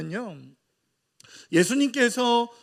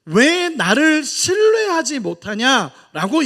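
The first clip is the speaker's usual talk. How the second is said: louder, slow reproachful question; Jesus rebuking; 5 semitones higher, 9 dB louder, slower than usual. Acted. Jesus questioning disciples' trust, loud slow reproach. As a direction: drag it out like a hurt teacher. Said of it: "예수님께서 말씀하시는 거예요".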